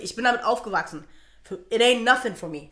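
ordinary talk, nothing marked

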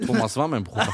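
joking line leaning on "war"; stress on "war". Real, not acted. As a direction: put the weight on "war", low-pitched. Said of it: "Betonung auf war".

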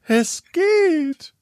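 high breathless gasp; gasping for air. Acted. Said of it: "japste sie". (hoch gejapst)

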